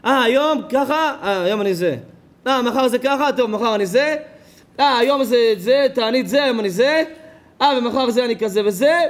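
mocking imitation. Mimics fickle people's excuses.

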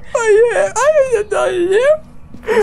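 very high, drawn-out loud wail; mock wailing. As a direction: wail like a rejected child, hammed up. Wailing at ghost who ignores him.

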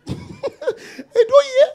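high, drawn-out mock voice with a laugh; mocking imitation. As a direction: mimic the listeners in a high, slow squeal. Mimics people refusing to hear accent.

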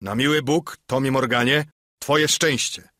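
hammed-up innkeeper outburst; exclaiming. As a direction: warn Morgan he was lucky. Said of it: "zawołał gospodarz".

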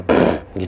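low imitated "ahem"; short mimicked throat clear. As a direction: clear the throat like an unseen presence. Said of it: "ada suara, eh, nge-D M".

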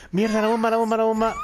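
high, alarmed cry about the bomb; sudden panic, voice jumps up; panicked. Real, not acted.

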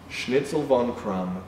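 usual speaking voice

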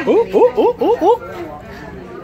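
high excited laughing ooh; delighted squeals. Real, not acted. Delighted at moving Minion.

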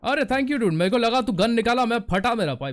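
high, loud, rapid relieved outburst; excited relief. Real, not acted. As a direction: blurt it out fast after a scare. Relieved after a gun scare.

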